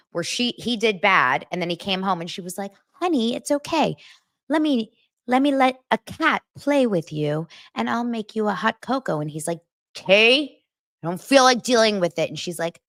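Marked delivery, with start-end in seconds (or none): 2.9-9.2 higher, sweet coaxing voice; mock soothing. [name] comforting [name] after a lost game, sweetly cooing. 10.0-12.3 louder, higher sulky brush-off. Pretend. [name] brushing off [name]'s comfort, a mock sulky grumble.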